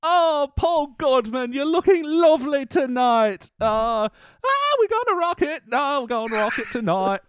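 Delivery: high, theatrical rock frontman; hammed-up British stadium singer. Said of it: "What about [name]?".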